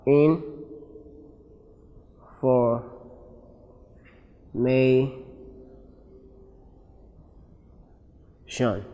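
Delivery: INFORMATION split into in, for, may, shun with long pauses; syllable by syllable. Shown as a demonstration. Teacher breaking "information" into syllables, syllable by syllable. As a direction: break "information" into four separate, drawn-out syllables. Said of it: "break this word into syllables".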